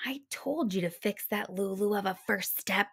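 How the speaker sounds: soft, breathy whispered grumble; muttered whisper. Acted. Mama grumbling to Papa about the broken step, whispered. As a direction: hiss it under your breath, annoyed. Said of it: "muttered Mama to Papa".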